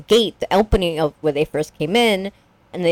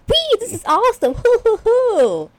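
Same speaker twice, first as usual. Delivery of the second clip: high-pitched imitation of a thrilled little brother; gleeful whooping. Host mimics the brother loving the coffin ride, gleeful whooping. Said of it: "And then his tune changes".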